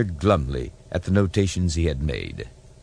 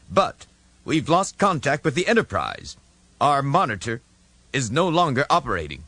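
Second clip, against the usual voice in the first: admiral Iota voice; 9 semitones higher than usual. Acted. Admiral Iota speaking on screen.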